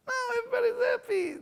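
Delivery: high, fast, whimpering squeak; high fast mock whine; mock crying squeak.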